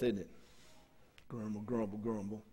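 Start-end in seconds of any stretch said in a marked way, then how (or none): 1.3-2.4 a mild mock grumbling employee, softer; muttering worker. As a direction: grumble it under your breath like a sulky worker. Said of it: "Under your breath".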